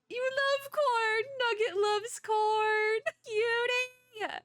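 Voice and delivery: very high, drawn-out cooing. Real, not acted. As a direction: squeal adoringly at a pet, slowly. Baby talk while feeding pet.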